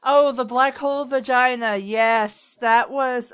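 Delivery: normal talking voice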